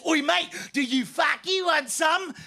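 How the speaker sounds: drawn-out London thug shout; London hooligan challenge. Acted. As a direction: goad them like a London yob. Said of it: "Four big fat football hooligans".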